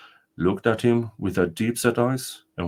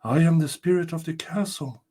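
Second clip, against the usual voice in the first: higher, solemn ghostly spirit; castle spirit; 7 semitones higher than usual. Acted. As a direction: announce yourself as the ancient spirit.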